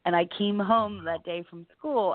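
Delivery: normal talking voice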